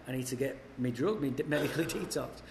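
quiet, stumbling slur with a laugh on "medically detoxed"; softly slurred drunk plea; mock drunken mumble. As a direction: trip over "drug" and "medically" like a drunk, laughing. Drunk self quoted to cops.

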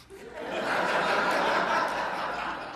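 a breathy, rolling laugh. Real, not acted. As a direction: laugh at the joke on yourself. Laughing at son's retort.

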